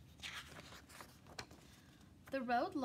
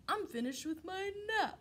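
lazy cow; 8 semitones higher than usual. Acted. Cow voiced in story.

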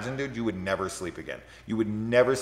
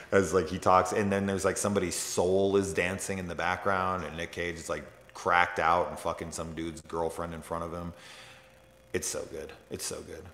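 usual speaking voice

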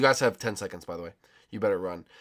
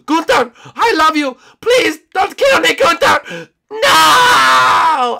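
high screamed begging; hammed-up panicked pleading; 17 dB louder, much slower than usual. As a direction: shriek for mercy as the chaser closes in. Begging chaser for life.

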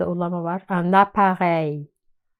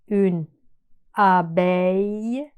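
une abeille drawn out, abeille as a-bey; very slow model word; slower than usual. Shown as a demonstration. Teacher slowing une abeille for students. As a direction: slowly stretch une abeille.

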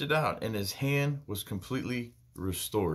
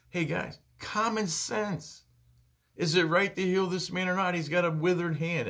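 voicing Jesus plainly; 7 semitones higher than usual. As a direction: lay it out to the crowd like a plea. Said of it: "if we just spoke plainly".

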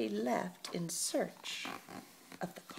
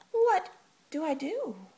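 excited nerves; 11 semitones higher, 4 dB louder than usual. Acted. Excited nervous girl.

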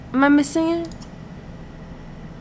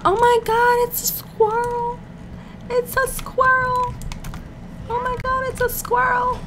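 excited squeal; 11 semitones higher than usual. Real, not acted. Spots a squirrel arriving as guest.

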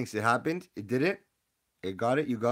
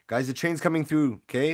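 train's coming, called out loud; loud announcing; 4 semitones higher, 6 dB louder than usual. Real, not acted. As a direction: call it out like a conductor.

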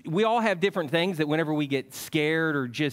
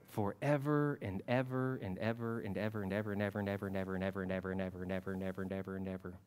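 softer, lower and fast, trailing away; fast fading forever; 6 semitones lower, 7 dB quieter, faster than usual. Acted. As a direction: rattle off ever playfully until it fades. Playful endless prayer ending.

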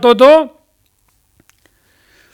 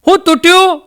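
high, loud call from outside the room; 7 semitones higher, 5 dB louder than usual. Retold or imitated. Mother-in-law calling out to the kitchen, high and loud. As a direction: shout it from the next room. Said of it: "હાહુએ બહારથી બૂમ પાડી".

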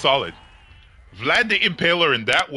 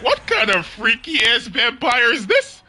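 shocked victim; 7 semitones higher than usual. Retold or imitated. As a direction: cry out like a horrified victim, laughing. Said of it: "victims for Lester are gonna be like".

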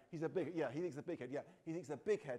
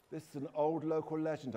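ordinary delivery

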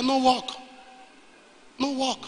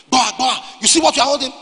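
raised, louder shouting at the pastor; mock shout; 5 semitones higher, 6 dB louder than usual. Armed man demanding to know what he holds, raised shouting. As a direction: call out like an armed man barking an order. Soldiers shouting at him in retold scene.

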